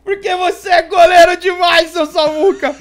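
loud, high cheer for the goalie; excited cheering. Real, not acted. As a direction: cheer him on at full voice. Cheering teammate's save. (torcida animada)

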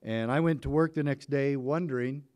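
ordinary talk

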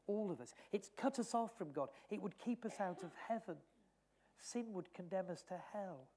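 ordinary talk

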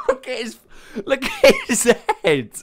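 high, squealing words through laughter; real laughing fit; helpless laughter. Real, not acted. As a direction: crack up while trying to speak. Cracking up at owl picture.

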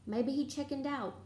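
usual speaking voice

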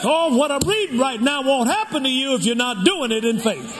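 ordinary talk, nothing marked

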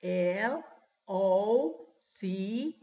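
long, separated English letters; spelling out slowly. Shown as a demonstration. Teacher reminding class of the missing C, slow spelling. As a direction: dictate letter by letter. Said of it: "yo les deletreé hace un momento".

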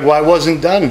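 mock defensive. Pretending to defend himself to his daughter, loud and indignant. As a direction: fake an excuse, loud and high. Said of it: "I'd be like".

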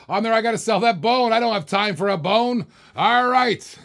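loud, high, rising auction call; excited auctioneer. Real, not acted. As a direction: get carried away calling the bids. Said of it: "the heightened voice of my excitement".

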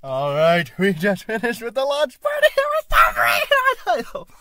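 gushing, high and loud; bursting with launch party excitement; excited. Real, not acted. Excited post-launch party recap.

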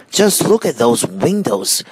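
lightly voiced excited monkey; excited cry. A narrator's reading. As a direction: exclaim it with eager wonder. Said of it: "cried the monkeys".

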